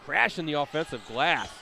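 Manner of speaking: excited shouted play call; big loud hype call; sideline yelling. Real, not acted.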